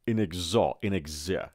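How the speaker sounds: "inexor-" broken off, with gz in place of ks; mispronounced X. Shown as a demonstration. Teacher quoting the wrong start before saying no, mispronounced "inexor-". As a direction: say "inexor-" as "in-eg-ZO", buzzing the z. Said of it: "نه دوستان عزیز".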